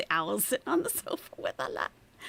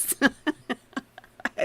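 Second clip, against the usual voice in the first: loud laugh; 6 semitones higher, 6 dB louder than usual. Real, not acted. Reacting to the exchange.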